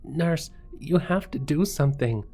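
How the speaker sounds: high, frantic plea for help; in hysterics. A narrator's reading. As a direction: beg the nurse, distraught and high-pitched. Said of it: "the woman said in hysterics".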